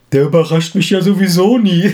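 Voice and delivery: hammed-up wife's complaint. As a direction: pout it like a disappointed wife. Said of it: "Auch genau mit der Stimme".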